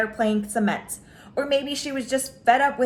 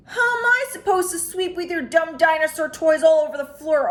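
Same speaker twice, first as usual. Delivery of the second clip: put-on higher, louder complaining young girl voice; a girl griping at her brother; arguing little sister; 5 semitones higher, 4 dB louder than usual. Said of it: "argued Sister".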